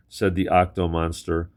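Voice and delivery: plain reading voice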